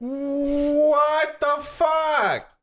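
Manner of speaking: stretched out, high, loud disbelief; shocked. Real, not acted. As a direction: drag it out in total shock. Shocked reaction to story.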